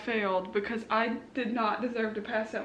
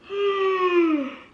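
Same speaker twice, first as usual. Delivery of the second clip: big sigh; 7 semitones higher, 6 dB louder than usual. Real, not acted. Nervous before checking scores.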